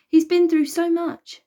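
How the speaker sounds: high, loud, slow, fighting tears; holding back tears. A narrator's reading. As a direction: push through rising tears. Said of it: "biting back the tears".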